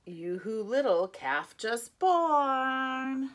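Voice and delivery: ordinary delivery, nothing marked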